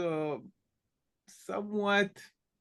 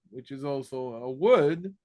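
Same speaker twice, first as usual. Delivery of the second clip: ordinary talk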